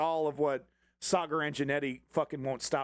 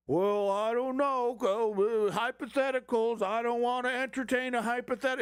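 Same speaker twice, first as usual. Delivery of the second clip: whiny hedging bureaucrat, pitched up; mock evasive judge; 6 semitones higher than usual. Mocking partisan judges dodging the plain law, in a high hedging voice.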